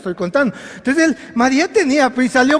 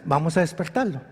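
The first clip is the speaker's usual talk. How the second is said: low, soft quoted line; understated quote; 7 semitones lower, 8 dB quieter than usual. Retold or imitated. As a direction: say it quietly and low, almost casually. Jesus quoted, understated first take.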